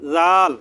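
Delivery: জাল said with the tongue tip on the upper teeth, a th-like z; dhal sound. Shown as a demonstration. Teacher showing students জাল with the tongue on the teeth. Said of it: "জিহ্বার আগা ... দাঁতের আগার সাথে লাগিয়ে বলবেন".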